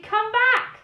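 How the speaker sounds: loud, high call after them; shout. Acted. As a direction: bellow it while lumbering after. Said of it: "The dragon shouted".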